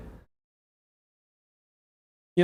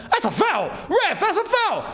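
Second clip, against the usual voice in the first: rising higher to a high plea at the ref; calling out a foul; 11 semitones higher than usual. Real, not acted. Streamer appeals to the ref mid-match.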